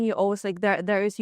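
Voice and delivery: ordinary talk, nothing marked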